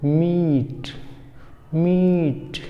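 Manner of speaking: मीट with long ee and aspirated ठ; teacher demo of मीट. Shown as a demonstration. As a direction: stretch ee and end मीट on a breathy ठ. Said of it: "क्या बोलेंगे?".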